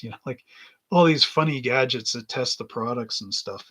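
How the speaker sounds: ordinary talk, nothing marked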